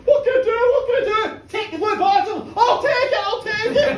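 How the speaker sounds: high, loud, frantic pleading; panicked Mick. Acted. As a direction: grab at the lifeline in a panic. Mick panicking, begging for bottle.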